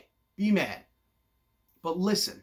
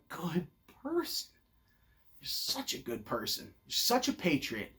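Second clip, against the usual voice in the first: low, hushed mock admiration; fake-sincere sneer at their virtue; sarcastic praise; 3 semitones lower, 9 dB quieter than usual. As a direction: drop the voice low and mock them gently. Sarcastic jab at self-righteous voters.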